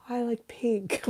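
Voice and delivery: whispered, tiny subdued reply; mock sad voice. Shown as a demonstration. Streamer demonstrating sad small talk, faint whisper. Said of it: "if I'm talking like this".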